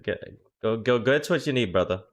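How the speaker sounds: ordinary delivery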